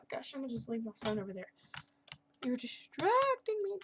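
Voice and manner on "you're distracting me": mock scolding. Real, not acted. Scolding her phone.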